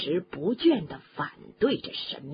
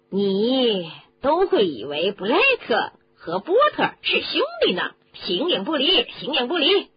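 high, loud, excited professor; eager agreement; 5 semitones higher, 6 dB louder than usual. A narrator's reading. Professor chiming in about two inseparable friends, high and excited. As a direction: chime in keenly with pitch leaping up. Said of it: "菲利维教授插话表示赞成".

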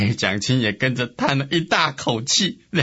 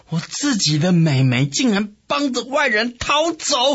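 high-pitched outraged outburst; angry shout; 8 semitones higher than usual. A narrator's reading. Sun Quan furious at his sister's escape, angry yell. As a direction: cry out in indignation. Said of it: "气得大叫".

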